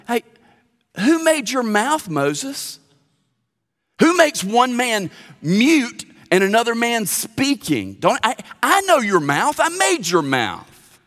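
high, loud, slow rebuke; God answering. Acted. God rebukes Moses for his excuses, high and emphatic. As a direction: challenge him firmly. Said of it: "What did God say".

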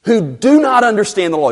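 high, haughty mock incredulity; hammed-up pompous scorn; self-important. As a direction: play a Pharisee looking down on the crowd. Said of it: "with self-importance".

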